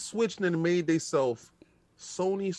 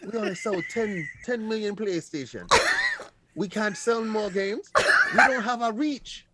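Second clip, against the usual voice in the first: a higher, put-on Jim Ryan accent, half laughing; the Sony boss fretting about reach, in a mock Jim Ryan accent; 7 semitones higher than usual. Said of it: "can't with the accent, the hat".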